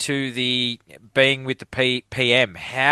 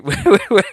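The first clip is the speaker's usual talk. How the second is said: burst of laughter; 8 semitones higher, 6 dB louder than usual. Real, not acted. Laughing at his own tangent.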